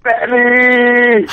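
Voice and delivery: high loud call for Betty. Acted. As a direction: yell the name out high. Calling out in mock outrage.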